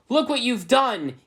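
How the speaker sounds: very high, loud, slow wail of a yell. Acted. Svetlana blaming Ebony, a very high loud yell. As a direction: scream the blame at her. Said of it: "yelled Svetlana".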